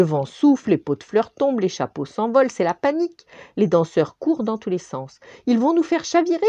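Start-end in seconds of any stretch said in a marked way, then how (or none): 5.5-6.4 much higher alarmed cry; panic, the boat is tipping; exclaiming in alarm. Acted. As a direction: accordion crying out in fear. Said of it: "s'exclame l'accordéon".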